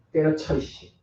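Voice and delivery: muttered "때려쳐, 이씨" in a different voice; fed-up mock curse; frustrated quitting. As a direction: grumble it like you're giving up in disgust.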